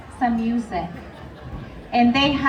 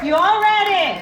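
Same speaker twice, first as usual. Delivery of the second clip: high, raised shout to the room; crowd-rousing call; 5 semitones higher than usual. Real, not acted. Host checking crowd before contest, calling out high. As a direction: holler it to get a cheer.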